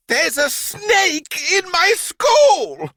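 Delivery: slow, high, loud headmistress shriek; shouted. A narrator's reading. Headmistress shouting on seeing the snake in the playground. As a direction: bellow it like a furious headmistress. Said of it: "she shouted".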